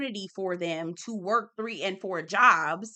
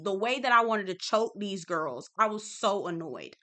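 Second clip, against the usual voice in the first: usual speaking voice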